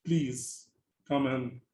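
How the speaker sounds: normal talking voice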